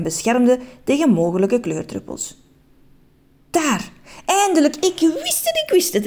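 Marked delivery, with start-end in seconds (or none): 3.5-6.1 high, thrilled shouts of discovery; eager triumphant calling; excited cry. A narrator's reading. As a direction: call it out like a long-sought prize found. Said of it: "riep meneer Just opgewonden".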